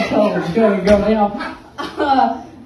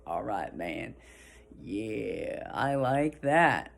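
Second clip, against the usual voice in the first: low, soft, slow stoner voice; happy dazed stoner; 9 semitones lower, 10 dB quieter, much slower than usual. Acted. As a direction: let it ooze out, mellow and low. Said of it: "happy mood and daze".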